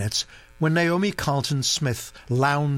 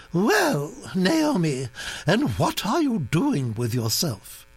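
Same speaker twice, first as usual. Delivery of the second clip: put-on high, brisk aristocratic old lady; old duchess voice; 6 semitones higher than usual. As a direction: gossip like an imperious old duchess. Duchess questions Naomi.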